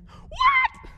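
very high shouted gasp of a question; Mary suddenly recognizing him; shrieked mock surprise; stunned surprise.

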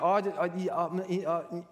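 imitating a flustered stammerer; stammering. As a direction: stumble and stall on the first word. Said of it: "he stammers and, and, and stutters".

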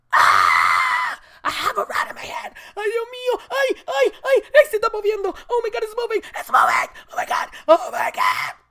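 high, loud panicked screaming; freaking out. Retold or imitated. She feels the rat move in the bag and panics, high screaming. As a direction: scream with the rat squirming in hand. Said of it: "that's when I freaked out".

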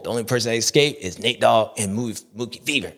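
ordinary talk, nothing marked

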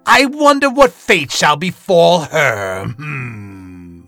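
loud, drawn-out theatrical narrator; dramatic host narration. Acted. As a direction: savour the cliffhanger like a villainous host.

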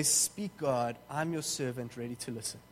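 low, soft, trailing prayer; hushed reverence. Acted. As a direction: offer it quietly and humbly to God. Quiet reply to God taught.

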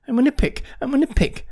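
very high, loud little chick; demanding. A narrator's reading. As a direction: insist like an overexcited fledgling. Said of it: "demanded Jerry".

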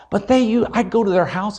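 ordinary delivery

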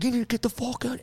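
high mock snarl; hammed-up angry bro order; gym bro. Gym bro ordering the arborists off, mock snarl. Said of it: "It's like".

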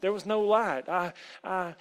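loud, high, halting panic; terrified stammer. A narrator's reading. Terrified boy explains himself to the woman, stammering. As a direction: stammer out excuses in fright. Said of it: "Tom was terrified ... He stammered".

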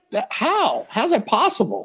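high squeaky disbelief; how is that even possible, high; incredulous. Real, not acted. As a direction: ask it with pitch shooting up. Disbelief at hidden family.

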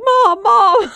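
put-on high, loud panicked kid calling Mom. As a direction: yell for Mom like a startled little boy. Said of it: "And he's like".

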